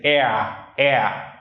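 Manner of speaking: "air" stretched into a diphthong, countryside Vietnamese accent; countryside Nha Quê accent. Shown as a demonstration. Teacher shows what they say, countryside Vietnamese accent. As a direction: rural Nha Quê gliding vowel on "air". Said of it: "countryside Nha Quê accent".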